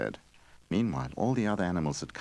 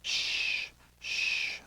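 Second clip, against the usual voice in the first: soft breathy shushing; hushing shh; 6 dB quieter than usual. A narrator's reading. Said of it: "the tree said".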